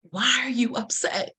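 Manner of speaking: hushed, voiceless whisper; spirit's whisper. Retold or imitated. Her spirit whispers to her after the firing, whispered. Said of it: "was whispering to me like".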